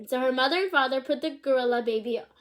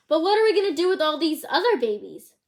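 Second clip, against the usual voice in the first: yelling; 5 semitones higher, 5 dB louder than usual. Acted. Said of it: "yelled Kristen's father".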